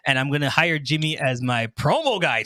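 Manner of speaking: ordinary talk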